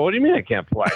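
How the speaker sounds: high, incredulous pushback; indignant retort. Retold or imitated. Golfer retorting to the tee official in disbelief.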